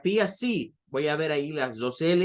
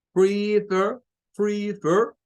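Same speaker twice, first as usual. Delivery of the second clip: "prefer" said louder and higher, syllable by syllable, stressing "fer"; 3 semitones higher, 4 dB louder, much slower than usual. Shown as a demonstration. Teacher showing learners the tonic syllable, "prefer" stressed on "fer". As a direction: demonstrate "prefer" with the force on the last syllable. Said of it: "esa es la sílaba tónica".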